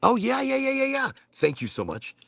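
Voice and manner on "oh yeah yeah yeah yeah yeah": high eager yeahs, tumbling out; light up, finally remembering; excited realization. Real, not acted. Suddenly gets the word.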